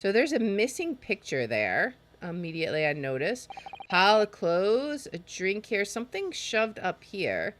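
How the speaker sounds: usual speaking voice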